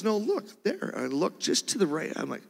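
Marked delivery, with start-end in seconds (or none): 0.0-0.9 wife insisting. Retold or imitated. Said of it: "She goes".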